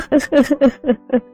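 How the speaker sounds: high staged boo-hoo crying; acted sobbing. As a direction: break down into sobs. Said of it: "অজস্রে কান্না করতে থাকে".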